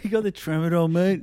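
high, loud put-on voice; wired party bloke. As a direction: ham up the party guest. Plumber party voice.

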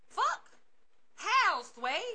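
high squealed curse. Real, not acted. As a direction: yelp it, pitch jumping way up. Outraged squeal.

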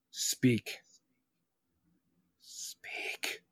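hushed, breath-only urging; whispered command. Pretend. Host coaxing a silent caller to talk, whispered. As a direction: whisper it as a slow, spaced-out command.

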